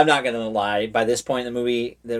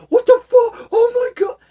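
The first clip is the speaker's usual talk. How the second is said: much higher, louder panicked yell; freaking out; 11 dB louder than usual. Retold or imitated. Man wrecking coworkers' computers, screaming in panic.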